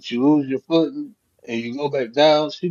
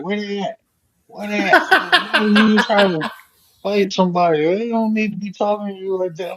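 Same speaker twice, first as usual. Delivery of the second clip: higher voice, ready to fight; hyped-up wife; 8 semitones higher than usual. Retold or imitated. Wife ready to fight the people who cussed him out, higher. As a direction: imitate her fired-up and eager.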